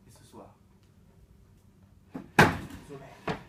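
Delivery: hushed close. Acted. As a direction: whisper the last word. Closing line.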